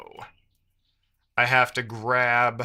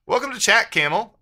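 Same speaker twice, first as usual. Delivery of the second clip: much higher, louder welcome; cheery welcome; 8 semitones higher, 6 dB louder than usual. Real, not acted. Streamer welcomes a newcomer, high and cheery. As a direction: throw a bright hello out. Greeting a new chatter.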